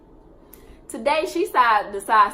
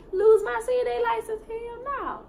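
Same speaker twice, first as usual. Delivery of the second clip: incredulous retort; 12 semitones higher than usual. Real, not acted.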